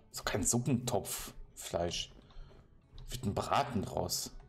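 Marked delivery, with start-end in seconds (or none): none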